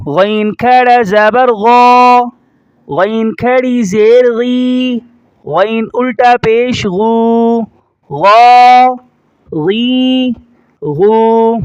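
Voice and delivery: slow drill, "غا" thick and rounded like "gho", held level; heavy ghain. Shown as a demonstration. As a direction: thicken "غین" and stretch "غا، غی، غو". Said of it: "اور موٹا کریں گے". ("غین" موٹا کر کے، کھینچ کر)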